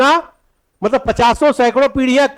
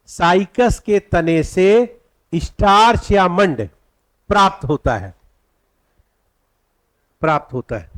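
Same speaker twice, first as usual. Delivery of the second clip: dictation; 2 semitones lower than usual. Shown as a demonstration. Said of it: "अब पहला point लिखिएगा".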